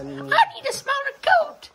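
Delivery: high, slow pretend dog voice; dog's voice. Voicing the dog asking for a smaller coat.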